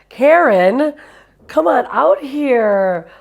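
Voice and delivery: high, loud, gushing greeting; excited welcome. Real, not acted. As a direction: call her out with delight. Host welcomes guest on stage.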